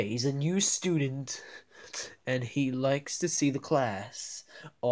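normal talking voice

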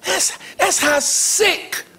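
ordinary talk, nothing marked

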